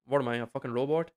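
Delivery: ordinary talk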